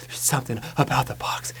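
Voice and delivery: breathy whisper, hammed-up arousal; mock orgasmic. Imitating her aroused while opening the box, mock-orgasmic whisper. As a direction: whisper it as if overcome with pleasure. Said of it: "like, orgasmic".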